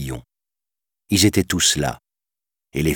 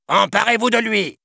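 much higher shrill-free panicked command; panicked order. A narrator's reading. Villain calling his guards, panicked. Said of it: "Paniqué, Scar alerta sa garde".

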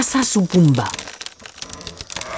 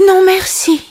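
much higher, weary character voice; sighing refusal; 12 semitones higher, 3 dB louder than usual. A narrator's reading. Simba turns down the grubs, high sighing voice. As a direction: refuse with a high-pitched sigh in the voice. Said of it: "soupira Simba".

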